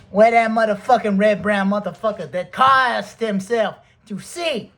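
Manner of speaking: high, loud mock fury; acted angry challenge. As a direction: call him out across the plantation. Acting Hendrix storming onto plantation.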